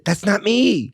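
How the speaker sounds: high-pitched retold outcry; indignant protest. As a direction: exclaim it in mock disbelief. Retold protest at hearing own song.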